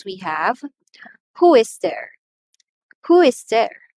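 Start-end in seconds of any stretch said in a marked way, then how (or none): 1.4-2.2 "Who" punched high and loud, then falling; stress on Who. Shown as a demonstration. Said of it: "we are going to stress that one". 3.1-3.9 "Who" much louder and higher than the rest; stress on Who. Shown as a demonstration. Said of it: "we are going to stress that one".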